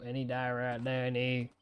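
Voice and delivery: ordinary talk, nothing marked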